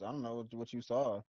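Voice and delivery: normal talking voice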